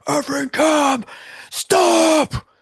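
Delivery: high, slow furious scream at the cab; furious yell. Retold or imitated. Drunk friend screaming at a taxi, furious high yell. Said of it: "Steve can yell like no one".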